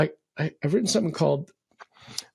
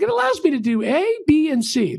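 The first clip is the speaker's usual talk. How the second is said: eager customer; 10 semitones higher than usual. Retold or imitated. Customer listing features eagerly.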